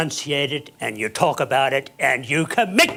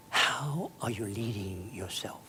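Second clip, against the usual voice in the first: whisper; 4 semitones lower, 9 dB quieter than usual. Acted. Whispered question to audience.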